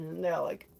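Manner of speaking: ordinary talk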